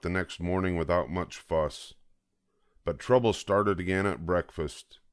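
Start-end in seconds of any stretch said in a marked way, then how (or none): none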